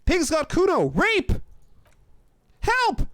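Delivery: high, loud squealing cry for help; high-pitched yelling kid; child's shout. A narrator's reading. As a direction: shout it shrill as a fire alarm, like a boy raising the yard. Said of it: "the child shouts at the windows".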